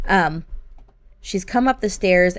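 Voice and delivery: usual speaking voice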